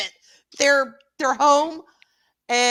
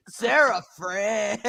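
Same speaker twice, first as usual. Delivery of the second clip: Ludo impression; slower than usual. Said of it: "Ludo. So bad".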